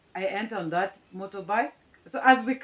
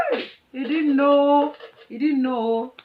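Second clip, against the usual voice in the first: drawn-out mocking hey, louder and higher; loud taunt; 6 semitones higher, 6 dB louder, slower than usual. Taunting the rude driver, loud and slow. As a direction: crow it slowly at the driver.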